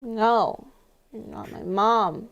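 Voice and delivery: put-on slurred, whiny tipsy girl; drunk voice. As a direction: play it drunk and stubborn. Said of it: "Ace does a really good drunk voice".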